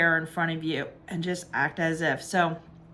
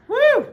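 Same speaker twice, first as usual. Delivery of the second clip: a real whoop, much higher and louder; excited woo; 8 dB louder than usual. Real, not acted. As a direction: let out a sudden joyful woo. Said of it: "Woo, I felt that so strong".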